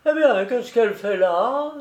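put-on a touch of drunken mirth; tipsy amusement. A narrator's reading. Keeper wondering what she does at the window, tipsily amused. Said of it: "dit-il avec ce rire, sans cause".

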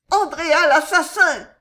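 high, loud scream of alarm. A narrator's reading. Young woman recognising the intruder, screaming. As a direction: shriek his name in terror. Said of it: "cria l'une des deux jeunes femmes".

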